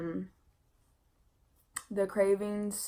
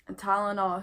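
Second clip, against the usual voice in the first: ordinary delivery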